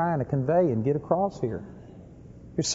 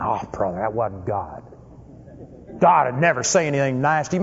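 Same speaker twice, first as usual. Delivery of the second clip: scoffing skeptic; 5 semitones lower than usual. Retold or imitated. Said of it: "Some of you think".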